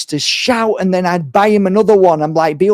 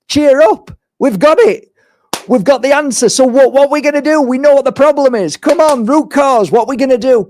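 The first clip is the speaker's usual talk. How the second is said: higher, louder pep-talk push; rallying cheer; 9 semitones higher, 4 dB louder than usual. Retold or imitated. Healer urging the parents on, higher and louder. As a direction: pump them up like a coach.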